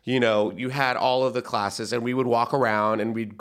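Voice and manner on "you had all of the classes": ordinary talk, nothing marked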